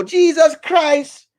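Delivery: outraged old goat reacting to gossip; shocked goat. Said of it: "Oxtail go".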